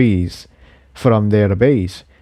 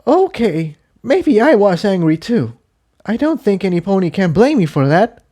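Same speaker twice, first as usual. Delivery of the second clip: put-on high young mare voice, softening; mare relenting; 10 semitones higher than usual. A narrator's reading. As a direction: admit it after a sigh. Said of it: "before sighing".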